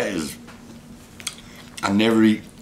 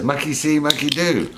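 higher playful monkey voice; voicing the monkey; 7 semitones higher than usual. Owner voices Happy in a light, higher playful voice. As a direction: speak for the monkey, teasing. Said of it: "Happy says".

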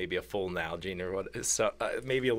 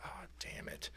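whispered curse; 17 dB quieter than usual. Retold or imitated. Hunter hears a missed shot.

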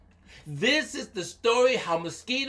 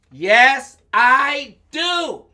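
loud, higher, word-by-word answer; emphatic mosquito; 5 semitones higher, 12 dB louder, much slower than usual. Acted. Mosquito accepting elephant's help, loud and emphatic. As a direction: play a little mosquito answering with all its might.

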